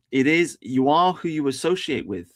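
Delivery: ordinary delivery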